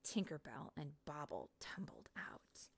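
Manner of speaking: ordinary delivery, nothing marked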